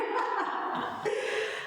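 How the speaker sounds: high, breathy laugh. Real, not acted. Amused by her own accent slip, high laughter.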